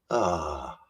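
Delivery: normal talking voice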